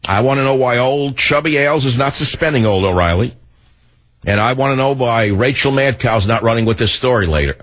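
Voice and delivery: normal talking voice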